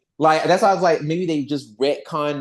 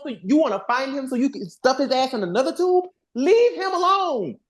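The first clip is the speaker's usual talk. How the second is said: higher, heated pleading, leave him alone; exasperated rant; 8 semitones higher than usual. Real, not acted. Fan rants at Billy to leave Zordon alone, high and exasperated. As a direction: vent at Billy like he's being ridiculous.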